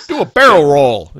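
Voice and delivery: loud, hammed-up catchphrase yell; big comic holler; shouted command. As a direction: belt out a joke order. Joking quoted command.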